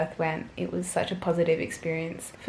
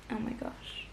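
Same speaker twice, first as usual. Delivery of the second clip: awed whisper; 3 semitones higher, 13 dB quieter than usual. Real, not acted. Overwhelmed remembering the moment.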